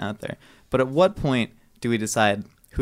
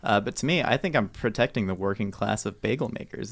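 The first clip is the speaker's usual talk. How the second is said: usual speaking voice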